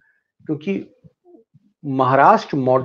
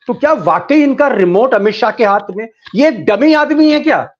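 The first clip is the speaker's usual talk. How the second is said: rising, louder scoffing question; loud mocking disbelief; 7 semitones higher, 6 dB louder than usual. Real, not acted. Anchor scoffing loudly at the Speaker being a dummy. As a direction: scoff at it as if it is absurd. Anchor mocks claim about remote control.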